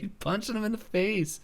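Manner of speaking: high-pitched imitated exclamation; imitated cartoon boy. Boy sees Stan fighting pterodactyl, high imitated voice. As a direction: squeak it out far above your own pitch. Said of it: "Tipper's like".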